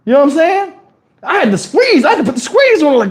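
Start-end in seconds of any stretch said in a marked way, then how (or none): none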